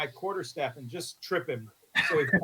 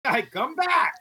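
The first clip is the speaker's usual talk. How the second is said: high playful holler; yelling; 10 semitones higher, slower than usual. Pretend. Player calls the runaway companion back, high yell. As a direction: call after someone, pitched well up. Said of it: "I'm gonna yell at him in Orcish".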